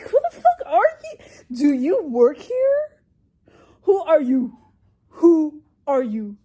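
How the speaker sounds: high, loud incredulous retort; indignant shouting. Retold or imitated. Acting out a retort to coworkers asking, indignant shouting. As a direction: demand it loudly, drawing words out.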